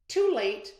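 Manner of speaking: high, loud smug gloat; smirking taunt. Acted. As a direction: rub it in with a smirk. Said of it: "Abby smirked".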